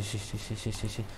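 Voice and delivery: ordinary talk, nothing marked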